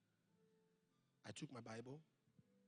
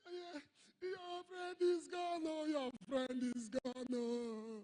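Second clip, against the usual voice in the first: high, slow crying wail of a grieving wife; mourning widow sobbing out her grief; 9 semitones higher, 4 dB louder, much slower than usual. Retold or imitated.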